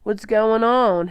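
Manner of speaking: frightened father through the door. A narrator's reading. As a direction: call through the door, worried. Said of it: "His voice was frightened".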